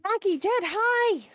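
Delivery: high, drawn-out happy hail; excited greeting. Acted. Pearl spots the mayor and her half-orc brother, excited greeting.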